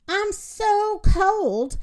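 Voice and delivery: high, drawn-out whine; complaining rooster. A narrator's reading. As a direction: whine slowly about being frozen. Freezing rooster complains to Moo.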